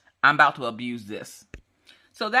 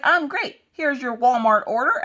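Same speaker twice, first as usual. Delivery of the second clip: mock bubbly delivery voice, much higher; imitating the DoorDash driver's high sunny tone; perky high courier; 10 semitones higher, 3 dB louder than usual. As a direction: chirp it brightly like a cheerful courier. Courier hands over order.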